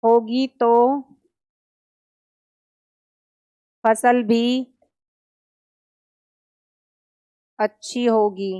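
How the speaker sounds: one word at a time with long pauses for writing; slow classroom dictation, word by word; dictating word by word. Shown as a demonstration. Teacher dictates a second example to students, slowly. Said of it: "दूसरा example लिखेंगे".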